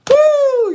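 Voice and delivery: high, loud "woo" after laughing; big whoop to recover from laughter; whooped exhale. Real, not acted. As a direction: let out a high whoop of relief.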